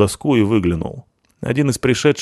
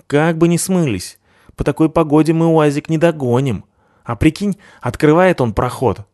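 higher, eager thug voice; 7 semitones higher than usual. A narrator's reading.

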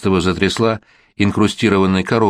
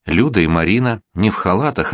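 usual speaking voice

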